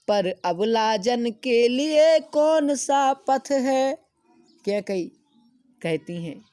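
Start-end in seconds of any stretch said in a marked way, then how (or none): 0.1-3.9 slow, high, loud declaiming; slow lofty verse reading; poem recitation. Acted. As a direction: chant the verse slowly to the class.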